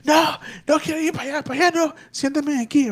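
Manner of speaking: high, fast, frantic mother; distraught mother begging to be sat down, high and rushed; shaken mom. Retold or imitated. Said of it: "And she goes".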